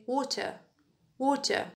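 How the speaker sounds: "water" the British way, with a hard T; British "waw-tuh", crisp T and no final R; British pronunciation. Shown as a demonstration. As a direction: demonstrate British "water", T fully sounded. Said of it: "In British dialect".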